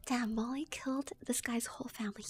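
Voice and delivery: ordinary delivery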